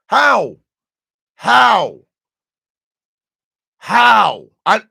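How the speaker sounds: high, loud, repeated disbelieving how; outraged how. Real, not acted. Venting to the crowd about being blamed, outraged.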